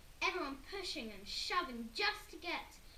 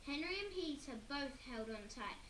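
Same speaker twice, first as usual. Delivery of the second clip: ordinary delivery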